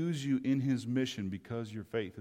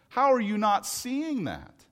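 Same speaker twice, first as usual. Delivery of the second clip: normal talking voice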